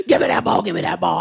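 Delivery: low, gruff toddler demand, barely voiced; aggressive toddler. Baby grandson demanding the ball, in a gruff mock voice. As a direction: growl like a bossy little boy. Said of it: "it scared me, he was like".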